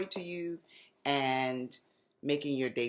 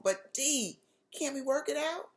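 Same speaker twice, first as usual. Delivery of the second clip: pleading cry; 12 semitones higher than usual. A narrator's reading. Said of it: "Mommy cried into the phone".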